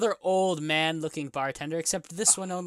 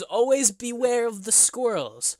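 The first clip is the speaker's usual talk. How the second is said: slow, higher warning, lightly voiced; old bartender; 7 semitones higher, slower than usual. Old bartender warning about squirrels, slow and ominous. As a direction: draw out an ominous caution. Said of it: "Polly goes".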